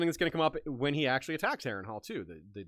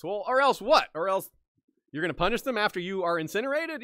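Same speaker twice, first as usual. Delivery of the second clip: higher, louder scoffing challenge; mock incredulous retort; 6 semitones higher, 5 dB louder than usual. Host answering Harren's imagined threat, mockingly incredulous. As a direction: throw the threat back in disbelief.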